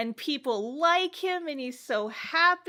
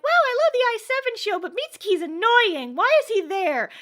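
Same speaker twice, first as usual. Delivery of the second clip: high, loud fast gossip; hammed-up high girly chatter; mock gossiping girls; 10 semitones higher, 7 dB louder, faster than usual. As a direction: rattle it off like catty fangirls.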